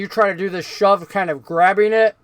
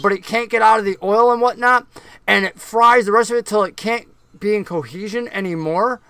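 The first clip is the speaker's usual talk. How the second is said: ordinary delivery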